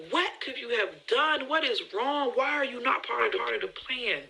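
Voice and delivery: put-on high, panicked pleading at her children; freaking out. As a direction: demand answers in a panic. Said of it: "I'm just freaking out".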